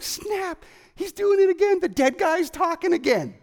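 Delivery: high, giddy amazement; a giddy disciple gasping at a talking dead man. Retold or imitated. Disciples seeing the risen Jesus, in giddy high amazement. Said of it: "They're like".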